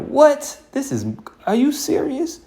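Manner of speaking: flabbergasted reader of history; shocked disbelief. Retold or imitated. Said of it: "they're gonna be like".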